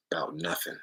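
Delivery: drops to a whisper on the last words. Real, not acted. Telling his followers he worries about nothing, whispered. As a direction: breathe it out, barely voiced.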